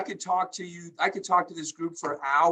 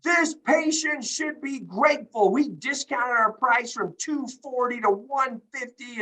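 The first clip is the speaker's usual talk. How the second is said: a touch of prim indignation, higher and slower; mock indignant; 6 semitones higher, slower than usual. Hospital clerk Sherry scolding the caller, mock indignant. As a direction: play the offended clerk, a little haughty. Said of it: "she was indignant".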